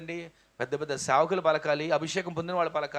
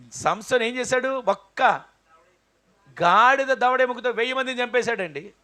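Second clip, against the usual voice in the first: preaching at full force; 7 dB louder than usual. Acted. Preacher thundering the bones story to the congregation. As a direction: drive the story home. Sermon climax.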